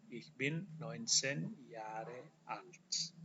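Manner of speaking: very slow, soft careful German; slow German demo sentence; slow model sentence. Shown as a demonstration. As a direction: spell it out for students slowly. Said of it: "Sama dengan bahasa Inggris".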